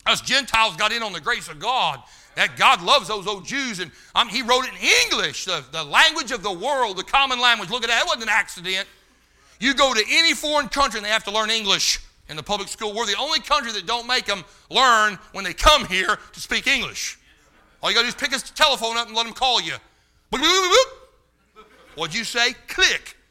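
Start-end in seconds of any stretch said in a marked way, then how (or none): 0.1-19.7 loud, high, driving sermon voice; fiery preaching. Acted. Preacher raising his voice to the congregation, fiery. Preacher building to full force. 20.3-21.7 high, loud, slow mock phone beeping; phone beeps. Preacher mocking foreign phone callers with beeping sounds.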